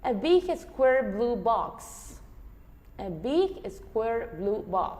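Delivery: ordinary talk, nothing marked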